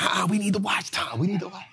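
soft mock Jose pleading for watch time; imitating Jose. Teasing coworker Jose about watch time, hushed mock plea. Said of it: "And Jose gonna be like".

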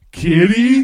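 simpleton hero. Acted. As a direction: ask it like a puzzled big oaf. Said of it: "look at Stanley and go".

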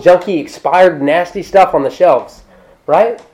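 ordinary talk, nothing marked

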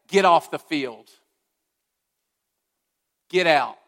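a coach benching his weak player; harsh dismissal. Pretend. Preacher voicing an imagined angry God, stern and loud. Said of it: "where we expect God to come in and say".

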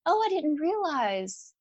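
high, slow, patronizing "good for you"; condescending pity; pity compliment. Retold or imitated. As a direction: sound sweetly condescending. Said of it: "she's like".

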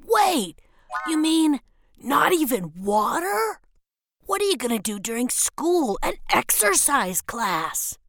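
high, put-on child voice, aghast; incredulous little brother. Brother asking sister about no water, incredulous. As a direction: question in wide-eyed disbelief.

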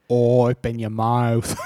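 slow, drawn-out mock Cockney; wobbly British accent sliding towards Australian; Cockney turning Australian. Shown as a demonstration. Coaching a friend's accent, slow Cockney gone slightly Australian. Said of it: "That got a little Australian".

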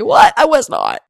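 very high, loud outraged yelp; mock indignant daughter. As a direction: squeak it like a teen denying everything. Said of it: "She's gonna say".

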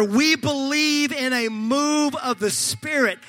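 normal talking voice

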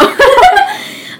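squealing laugh; high burst of laughter. Real, not acted. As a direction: shriek with laughter.

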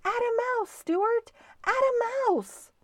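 very high-pitched crowd cheer; cheering and calling. A narrator's reading. People cheering Stuart back at the helm, high calling. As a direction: call out encouragement in a high voice. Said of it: "people cheering for him and calling".

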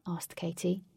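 plain reading voice

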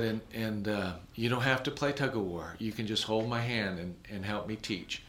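calm kind offer, fading quiet; soft coaxing. Retold or imitated. Comforting the crying child.

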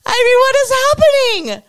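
high, loud disbelieving cry; exasperated outburst. Real, not acted. Host exasperated at Twitter staff crying, loud disbelief. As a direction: throw your hands up in disbelief.

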